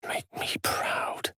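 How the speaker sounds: slow unvoiced whisper, close in. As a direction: breathe it softly like a secret. Game character whispers line.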